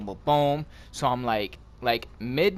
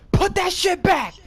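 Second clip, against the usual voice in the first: high, slow shouted order; yelling lady; 5 dB louder, slower than usual. Retold or imitated. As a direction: bellow it like an angry store clerk. Pharmacy lady yells at him.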